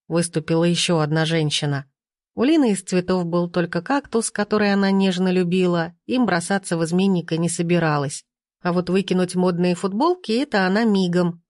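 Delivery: ordinary delivery, nothing marked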